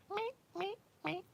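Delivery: high, soft little meows; imitated meows. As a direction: mew softly and high like the cat. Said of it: "He's like".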